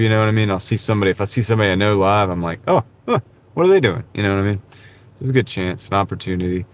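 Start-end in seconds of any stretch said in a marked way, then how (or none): 2.7-4.0 acted-out intrigued reaction, pitched high; curious surprise. Streamer imitating himself spotting someone live, curious surprise. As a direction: perk up as if just noticing.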